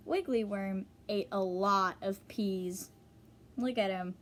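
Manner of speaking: ordinary delivery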